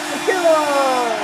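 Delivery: loud, stretched goal yell; goal call shout. Acted. Announcer shouting a goal call, loud. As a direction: roar it out like the arena's going wild.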